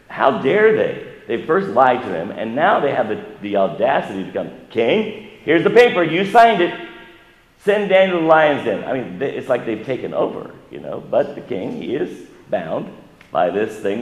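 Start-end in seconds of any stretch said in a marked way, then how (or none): 4.7-8.8 high, loud mock accusation; accusers' demand. Accusers confront the king with his signed decree, high and loud. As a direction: shove the signed paper at the king.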